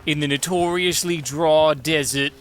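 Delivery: slow, hammed-up Australian accent; comedic Australian accent. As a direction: stretch it out in a mock Aussie accent. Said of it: "I can only do a comedic Australian accent".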